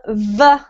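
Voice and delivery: "V" as a voiced "vuh"; V sound. Shown as a demonstration. Teacher contrasting with B, "V" as a buzzed vuh. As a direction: say "V" as vuh, teeth on lip. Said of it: "When we have the V sound".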